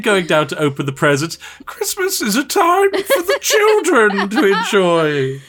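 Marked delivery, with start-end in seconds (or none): 1.7-5.4 very high, slow, hammed-up royal proclamation; mock Christmas speech. Partner mocks her childhood Christmas speech, hammed-up and pompous. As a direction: lampoon a pompous child's address. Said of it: "a bloody Christmas speech".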